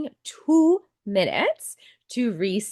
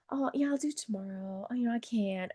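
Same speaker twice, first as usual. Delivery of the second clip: softly voiced excuse, much quieter; mock excuse, hushed; 3 semitones higher, 9 dB quieter than usual. Acting out a procrastinator making excuses, mock quiet. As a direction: mumble the excuse away. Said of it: "you're like".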